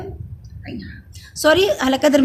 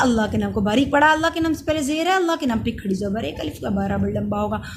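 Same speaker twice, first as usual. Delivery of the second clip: normal talking voice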